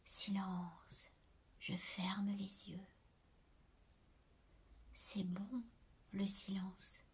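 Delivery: very soft, savouring whisper; low, restful hush. A narrator's reading. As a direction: barely breathe the words, relishing the quiet. Narrator savouring silence with eyes closed.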